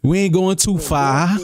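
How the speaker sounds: slow Boston "fah" for FAR; Boston accent. Shown as a demonstration. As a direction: stretch FAR without its R, Boston style. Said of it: "this is how you sound like you're from Boston".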